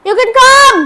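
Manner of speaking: high loud call to another room. Real, not acted. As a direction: yell for her to come in. Calling sister into room.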